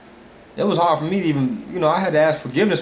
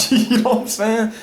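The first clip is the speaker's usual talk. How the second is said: high, laughing check-in; 7 semitones higher, 4 dB louder than usual. Real, not acted. Preacher amused by his own conversion example, laughing. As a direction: laugh through it, pitch jumping up.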